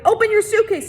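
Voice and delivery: high, loud command; shrill order. A narrator's reading. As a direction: bark the order at him. Warden orders Stanley.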